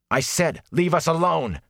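high, forceful angry demand; angry outburst. Acted. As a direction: whirl on him and snap it out. Whirls round, fist balling up.

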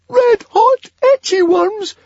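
very high, loud, slow squeal; panicked squeak. A narrator's reading. Fishlegs hears about the itchy worms, squeaking. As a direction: squeak each word in horror. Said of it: "squeaked Fishlegs".

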